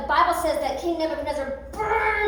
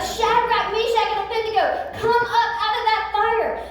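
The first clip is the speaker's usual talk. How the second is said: calling out; 6 semitones higher than usual. Acted. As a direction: summon them out of the fire joyfully. King calls them out of fire.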